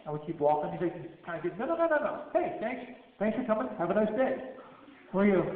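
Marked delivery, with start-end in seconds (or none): 1.6-4.4 high, bright no-no-no brush-off; insistent fake cheer. Guard shooing the visitors off, high mock-cheery insistence. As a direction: push them away with a forced smile.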